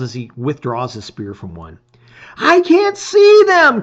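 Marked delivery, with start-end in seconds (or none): 2.4-3.8 high, loud, slow cry of frustration. A narrator's reading. Blinded lizard boy under attack, crying out. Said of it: "cries Sars".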